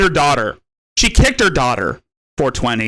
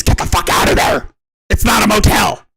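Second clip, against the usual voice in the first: raised, higher yell at the end; mock shout; 6 semitones higher, 3 dB louder than usual. Telling off someone who treats the place as a motel, mock shouting.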